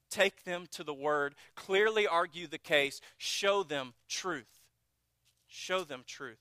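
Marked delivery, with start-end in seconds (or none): 3.2-4.6 slow, weighty, each word set apart; slow emphatic. Real, not acted. Preacher pressing the point on the congregation, slow and emphatic. 5.5-6.4 softer repeat, falling away; soft echo. Real, not acted. Preacher repeating the point to the congregation, softly.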